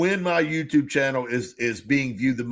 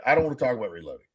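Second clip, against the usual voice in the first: lower, fast, brushed-off mock whine; dismissive Candy; 4 semitones lower, 4 dB quieter, much faster than usual. Mimicking Candy refusing to hear about reloading, dismissive. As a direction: rattle it off like you're sick of the topic. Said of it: "and Candy's like".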